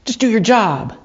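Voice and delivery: mildly worked-up, imitating a distraught man; agitated. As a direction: act out an agitated man challenging an officer. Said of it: "who's agitated looks at him and says".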